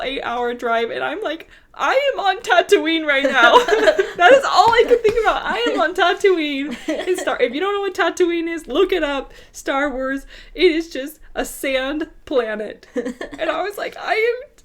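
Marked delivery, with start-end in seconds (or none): 1.7-3.6 higher and louder, comic disbelief; loud mock despair. Despair on desert bus ride. 3.6-6.8 much higher, laughing through words. Real, not acted. Laughing at own memory. 14.0-14.5 mock despair. Retold despair on bus.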